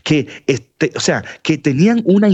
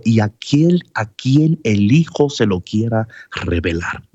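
scripture reading; 4 semitones lower than usual. Acted. Reads scripture aloud.

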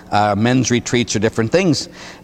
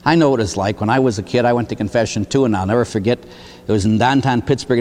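ordinary talk, nothing marked

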